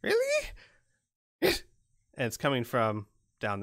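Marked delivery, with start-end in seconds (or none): 0.0-1.6 very high, squeaky goblin question; piping, squeaky creature voice. Acted. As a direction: squeak it like a surprised little goblin. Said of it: "squeaky voice".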